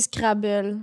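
the English word "Scrabble" said the French way; French accent. Shown as a demonstration. Explaining her own way of saying words, French accent on "Scrabble". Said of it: "je dis avec un accent français".

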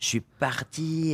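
slow, dazed from brain freeze. Real, not acted. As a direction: drift off as the brain freeze hits. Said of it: "ça me le fait maintenant".

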